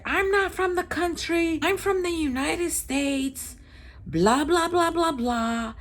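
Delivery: very high mimicked protest; mock yelling. Yelling man insisting where he's from, imitated high yell. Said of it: "Why are you yelling like this?".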